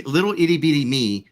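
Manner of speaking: normal talking voice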